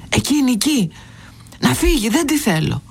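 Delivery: put-on high-pitched, upset child insisting; insistent. A narrator's reading. Angry boy answering the headmaster about the woman, insistent. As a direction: push each short phrase out high, with a falling end. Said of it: "επέμενε να λέει ο μικρός".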